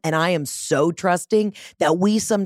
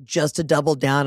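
normal talking voice

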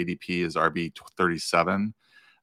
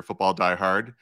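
ordinary talk, nothing marked

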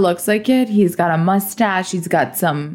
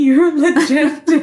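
laughing; 6 semitones higher, slower than usual. Real, not acted. Co-host laughs at the joke.

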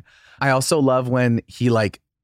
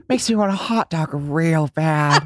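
quoted line; 4 semitones higher than usual. Retold or imitated.

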